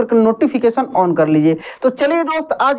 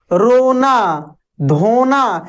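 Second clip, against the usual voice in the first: रोना drawn out very slowly; unhurried teacher's demo; much slower than usual. Shown as a demonstration. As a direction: stretch the vowel in रोना. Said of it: "एक आराम से एक जोर से".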